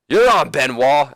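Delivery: loud, high, drawn-out acceptance; hammed-up manager taking the challenge; Ric Flair. Manager accepts on his wrestler's behalf, loud hammed-up shout. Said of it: "until Ric Flair says".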